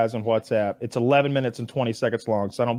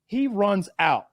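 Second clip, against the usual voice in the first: ordinary talk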